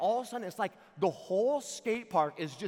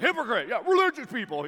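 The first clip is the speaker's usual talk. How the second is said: loud, high echo of an angry friend; imitating an indignant hanger-on; parroting sidekick; 7 semitones higher, 10 dB louder than usual. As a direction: blurt it out like a tagalong copycat. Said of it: "He just repeated everything that Mohawk dude did".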